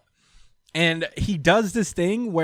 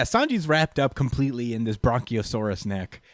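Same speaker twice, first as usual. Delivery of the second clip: usual speaking voice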